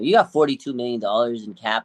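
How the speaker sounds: normal talking voice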